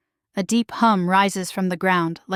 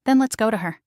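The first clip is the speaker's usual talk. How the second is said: plain reading voice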